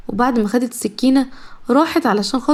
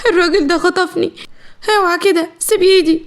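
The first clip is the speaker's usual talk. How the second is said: higher, pleading cry for help; tearful scream; 7 semitones higher than usual. A narrator's reading. Kidnapped young wife crying out to a stranger, tearful scream. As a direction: scream for help while pulling away. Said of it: "زهرة صرخت بعياط".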